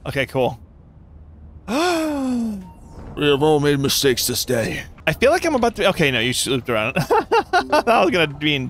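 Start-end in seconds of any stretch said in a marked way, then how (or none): 1.7-4.8 a gasp, then a mock grave proclamation; hammed-up solemn announcement. To teammates after a mistake, mock solemn.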